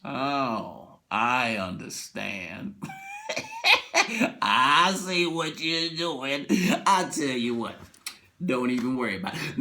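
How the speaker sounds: low, slow, conspiratorial voice; knowing hush. Retold or imitated. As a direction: drop low like you're in on it. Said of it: "and he looks like".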